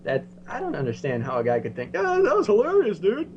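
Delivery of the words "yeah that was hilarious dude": mock dude voice. Mocking the radio host.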